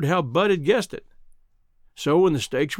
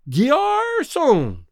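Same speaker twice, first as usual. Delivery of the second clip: very high, drawn-out call for the waiter; sudden cry; 10 semitones higher than usual. A narrator's reading. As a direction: holler across a restaurant, stretching the word. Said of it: "in a voice that paralyzed every knife and fork".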